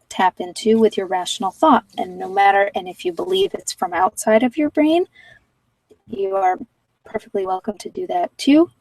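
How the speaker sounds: usual speaking voice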